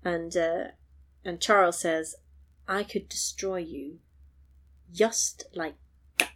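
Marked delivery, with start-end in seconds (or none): 2.7-6.3 slow, much softer menace; slow, hushed threat. Retold or imitated. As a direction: let the threat sink in quietly. Charles threatening Eddie.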